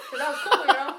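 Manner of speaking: loud laughter through the words; telling the story while laughing loudly. Real, not acted. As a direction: laugh it out remembering.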